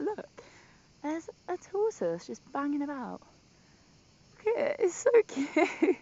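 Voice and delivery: high, loud squeal of adoration; gushing delight. Real, not acted. Vlogger spotting a tiny tortoise by the pool, gushing. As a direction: coo loudly and high, drawing out the words.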